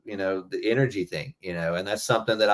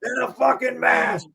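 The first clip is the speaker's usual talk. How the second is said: much higher shouted mock tirade; mock drill sergeant shout; imitating Joey; 5 dB louder, slower than usual. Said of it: "I'll never forget him saying".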